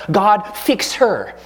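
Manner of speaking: bossy prayer. Retold or imitated. Husband angry at his wife, slow, demanding prayer. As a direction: drag out the demand on God. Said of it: "my prayers inevitably start out".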